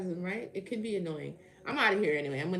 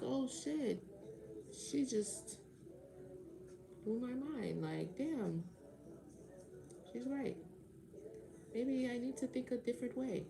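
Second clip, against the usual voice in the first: higher, softer awed listener; imitated realization; 4 semitones higher, 8 dB quieter, slower than usual. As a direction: play someone quietly having their mind changed.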